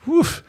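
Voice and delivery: high, amazed woof. Retold or imitated. Husband impressed by his wife's new dress, a high woof. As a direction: let out a wolf-whistle woof.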